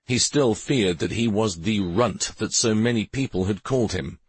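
usual speaking voice

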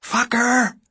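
loud high scream. A narrator's reading. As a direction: scream it with fury.

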